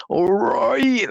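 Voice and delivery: Bristolian ALL RIGHT, high with a hard, drawn R; exaggerated high Bristolian greeting; Bristolian accent, heavy R. Shown as a demonstration. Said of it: "emphasis on the, uh, the letter R".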